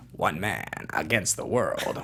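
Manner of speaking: deep, slow movie trailer announcer; low, drawn-out eighties trailer read. Shown as a demonstration. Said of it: "the eighties trailer voice would be".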